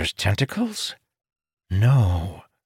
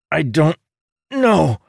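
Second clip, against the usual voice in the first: through clenched teeth; 9 semitones higher, 3 dB louder, slower than usual. A narrator's reading. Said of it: "replied through clenched teeth".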